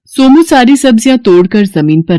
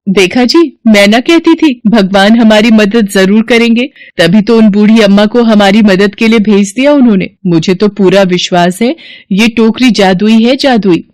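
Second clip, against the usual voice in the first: joyful wife; 3 semitones higher than usual. Acted. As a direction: tell him happily you were right all along. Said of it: "वो सोमू से कहती है".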